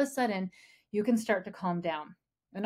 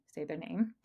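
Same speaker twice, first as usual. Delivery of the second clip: usual speaking voice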